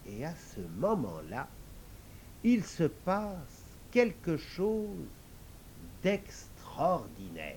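slow, hushed build-up; suspenseful storytelling. A narrator's reading. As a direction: hold back before the wonder. Suspenseful narration.

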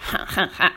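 acted storybook "ha, ha, ha"; staccato villain's laugh; hearty crocodile laugh. A narrator's reading. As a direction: laugh in separate, airy bursts. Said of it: "rit à belles dents".

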